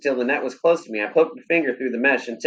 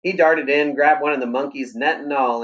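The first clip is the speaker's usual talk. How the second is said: plain reading voice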